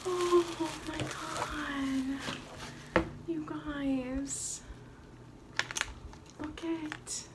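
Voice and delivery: soft, high, slow awe; hushed and high, overwhelmed by the gift; close to tears. Real, not acted. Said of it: "but I might cry".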